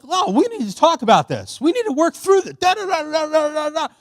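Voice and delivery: high, loud, worked-up pleading to talk; retold yelling. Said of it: "Why are you yelling at me?".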